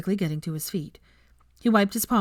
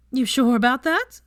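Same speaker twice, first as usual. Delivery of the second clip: high, louder sly teasing; smirking Veronica; 7 semitones higher, 6 dB louder than usual. Acted. Veronica smirking at red-faced Draco, sly teasing. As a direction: needle him with a smirk.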